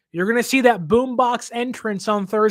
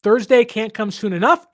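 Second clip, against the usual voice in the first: ordinary talk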